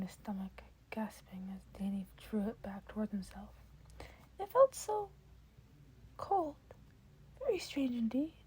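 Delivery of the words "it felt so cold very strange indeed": bright eager offer from a cheerful mentor; Nezu's voice. Acted. Nezu voiced by reader.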